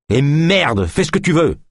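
high, angry surrender; exasperated outburst. A narrator's reading. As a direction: give up and snap it. Gives up struggle in exasperation. (exaspération, voix qui monte)

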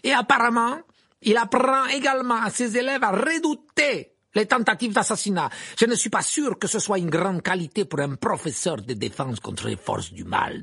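high, loud sneering jibe; mocking Karkaroff. A narrator's reading. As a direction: ridicule the old auror. Karkaroff raille Maugret. (Karkaroff sarcastique)

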